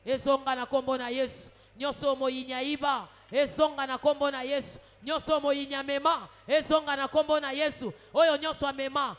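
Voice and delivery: loud, drawn-out rhythmic decrees; declaratory prayer. Real, not acted. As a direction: hammer out each declaration to the congregation. Leads forceful declaratory prayer.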